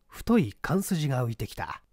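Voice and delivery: ordinary delivery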